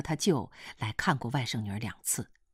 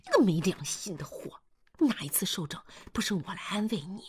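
hushed scolding; 5 semitones higher, 3 dB quieter than usual. A narrator's reading. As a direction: scold softly so nobody overhears. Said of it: "还有个女的在悄悄地说话".

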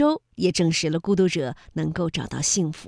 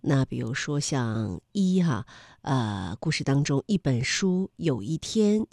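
ordinary talk

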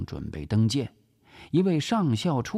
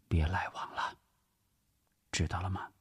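gentle but firm; 8 dB quieter, faster than usual. Acted. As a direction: insist gently, keeping your voice down. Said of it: "温柔而坚定". (温柔而坚定，轻声叮嘱)

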